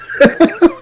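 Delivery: a high bright burst of laughter; loud laugh. Real, not acted. Laughing at own story.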